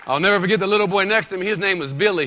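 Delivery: normal talking voice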